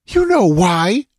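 high, slow, pointed woman's voice; indignant cry. A narrator's reading. Dancer answers the priest's feigned question, crying out. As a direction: cry it out in accusation. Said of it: "she cried".

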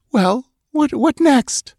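high, trembling question; shaky, frightened voice. Acted. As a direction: ask it shakily, dreading the answer. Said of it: "she asked in a shaky voice".

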